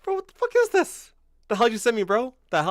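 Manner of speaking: very high, incredulous outburst; appalled disbelief. Real, not acted. Streamer reacting to a viewer's link, appalled disbelief. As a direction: recoil in high-pitched disgust at what was sent.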